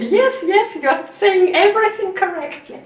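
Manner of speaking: quoting Swami's encouraging nod, high and happy; delighted approval. Retold or imitated. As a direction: beam it out like a pleased teacher. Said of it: "smiled and nodded".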